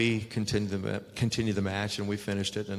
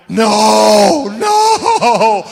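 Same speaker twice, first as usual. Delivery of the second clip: high, loud scream of pain; 11 semitones higher, 17 dB louder than usual. Retold or imitated. Wrestler screaming as the elbow hits his ribs. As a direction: yell no as the elbow lands. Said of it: "I'm going".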